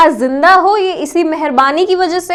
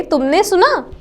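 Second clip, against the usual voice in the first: ordinary talk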